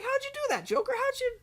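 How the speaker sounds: put-on very high, girlish squeal; fawning models. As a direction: coo it high like starstruck models. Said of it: "Models are like".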